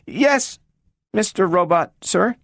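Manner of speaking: put-on a small girl asking after her lost friend; eager little girl. A narrator's reading. Gloria asks the talking robot about Robbie, high and hopeful.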